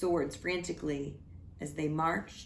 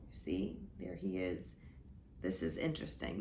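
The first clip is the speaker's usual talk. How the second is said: hushed aside, a bit quicker; whispered aside; 10 semitones lower, 8 dB quieter than usual. Real, not acted. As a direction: lean in and whisper it. Aside to listeners over book.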